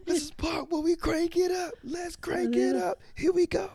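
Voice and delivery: high-pitched imitation hype shout; hype entrance call. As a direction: rev up the crowd like a wrestler.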